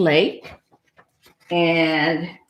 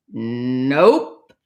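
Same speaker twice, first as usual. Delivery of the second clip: low, drawn-out "nope"; unbothered low duck reply; deadpan duck; 7 semitones lower than usual. Acted. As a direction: answer low with a long n. Duck answers cat's quiz in story.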